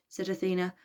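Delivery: ordinary delivery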